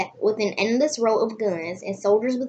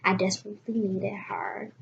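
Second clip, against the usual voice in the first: whisper; 8 dB quieter than usual. Acted. Reading sad passage.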